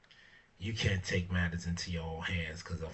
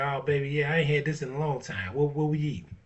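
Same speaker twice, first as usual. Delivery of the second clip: put-on higher, louder delighted girlfriend voice; happy girlfriend; 7 semitones higher, 7 dB louder than usual. Grateful girlfriend pulling up to Wendy's, higher delighted voice. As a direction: play the grateful woman thrilled by Wendy's. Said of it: "she gonna be like".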